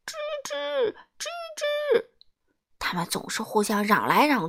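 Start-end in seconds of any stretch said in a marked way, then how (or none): 0.0-2.1 very high-pitched squeaks; mouse squeaking. A narrator's reading. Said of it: "最吵闹的老鼠". (尖细的老鼠吱吱叫)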